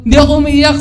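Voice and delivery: exasperated protest. Real, not acted. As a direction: insist loudly you weren't crying. Denying he was crying, exasperated.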